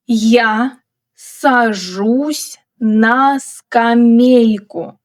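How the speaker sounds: slow, drawn-out model reading for learners. Shown as a demonstration. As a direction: stretch each word out for a learner to follow.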